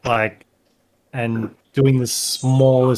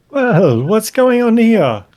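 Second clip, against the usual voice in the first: high, loud friendly cleric greeting; booming holy man; 10 semitones higher, 5 dB louder, slower than usual. Acted. GM as cleric greeting the party, loud and high. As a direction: call out like a surprised kindly priest. GM voices the holy figure NPC.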